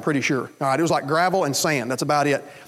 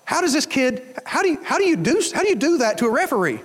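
high, incredulous disbelief; mock shock at the referee; dumbfounded question; 9 semitones higher than usual. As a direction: ask it stunned and baffled. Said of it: "he's a bit dumbfounded".

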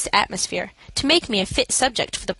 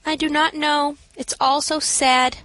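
slow, higher mournful drift; feigned sadness; 6 semitones higher, slower than usual. A narrator's reading. Narrator feigns sorrow to the matron, slow and mournful. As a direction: put on a sad, lost air.

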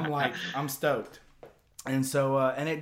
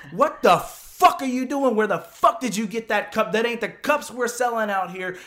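angry yelling; 10 semitones higher, 11 dB louder than usual. Retold or imitated. Truck owner confronting him over the beer cup, angry yelling. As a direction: yell it like a furious confrontation.

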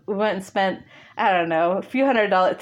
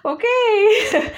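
high, laughing okay; a nervous giggly okay; half-laughing mock reassurance; 13 semitones higher, 4 dB louder than usual. Retold nervous reply.

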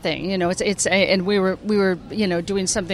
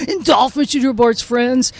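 higher-pitched cartoon kid; cartoon character voice; 5 semitones higher, slower than usual. Shown as a demonstration. Actress rattling off her characters, cartoon kid voice. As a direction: switch into a schoolyard character, pitched up. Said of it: "thank you very much for doing the voices".

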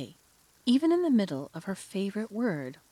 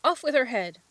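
higher, louder furious shout; 7 semitones higher, 7 dB louder than usual. A narrator's reading. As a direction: scream the sentence out.

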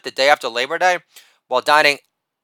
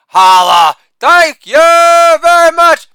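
Holla barked loud, then thank you very much stretched slow and very high; shouted catchphrase; 12 semitones higher, 8 dB louder, much slower than usual. Acted. Comic to audience, hammed-up sign-off, loud high drawn-out shout. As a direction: belt the sign-off like a showman milking applause.

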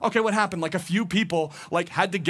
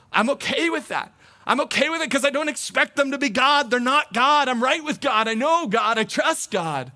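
higher and louder, triumphant; rising confident declaration; 7 semitones higher, 4 dB louder than usual. Real, not acted. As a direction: proclaim it with growing conviction.

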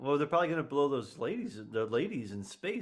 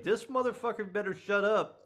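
higher, laughing put-on alien; mock alien voice; 8 semitones higher than usual. The alien fed up with the general, mock voice with a laugh. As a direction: grumble it as an annoyed alien. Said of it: "the alien was like".

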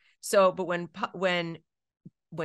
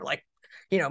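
ordinary talk, nothing marked

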